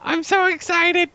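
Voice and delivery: high, slow, mock-excited falsetto; Ilrad. Pretend winner reacting to news of winning, silly voice. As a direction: draw it out in a silly squeaky voice. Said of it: "Stop doing that voice".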